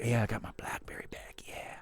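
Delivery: whispered gloating aside; breathy hushed whisper. Retold or imitated. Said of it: "He's gonna be like".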